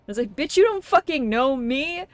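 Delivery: ordinary talk, nothing marked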